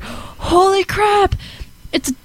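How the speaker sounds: slow, high, drawn-out amazement; surprised exclaim. Retold or imitated. Retelling walking out of the dark theater, slow surprised exclaim. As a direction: stretch it out in disbelief. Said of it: "And I was just like".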